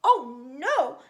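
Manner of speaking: loud alarmed cry of little pigs; the little pigs cry out to the shark; frightened cry. A narrator's reading. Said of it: "cried the little pigs".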